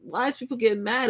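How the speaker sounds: high, incredulous what-is-going-on; baffled outburst. Retold or imitated. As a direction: throw it up high in disbelief. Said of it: "And I'm like".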